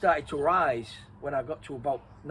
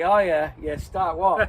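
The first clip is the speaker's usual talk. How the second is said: sceptical; 3 semitones higher, 9 dB louder, slower than usual. Retold or imitated. Thinks brother is winding him up.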